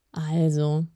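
slightly low, weary exasperation; a touch of frayed patience; exasperated. A narrator's reading. Deep-voiced elf calling the others back, exasperated. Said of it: "klang entnervt".

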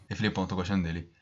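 imitando o amigo, sério e mais baixo; sério imitado. Retold or imitated. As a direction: fala seca e séria, um pouco mais grave e mais baixa. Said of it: "bem sério". (imitando o colega, sério, mais grave e mais baixo)

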